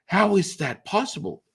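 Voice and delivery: high, astonished question; amazed friends. Retold or imitated. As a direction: ask it like you can't believe your eyes. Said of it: "their friends are like".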